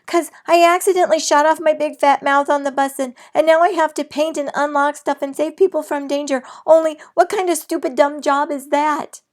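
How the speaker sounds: high, loud and fast; excited yelling. A narrator's reading. Girl yells her problem to her mother. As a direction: blurt it all out. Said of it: "I yelled to my mother".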